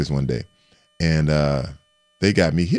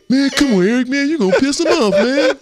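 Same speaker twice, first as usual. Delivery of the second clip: high, louder nervous plea; lineman pleading; 10 semitones higher, 5 dB louder than usual. Retold or imitated. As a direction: teammate Kevin begging Eric not to provoke, high and loud. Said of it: "Kevin Call".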